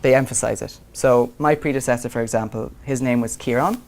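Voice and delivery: ordinary delivery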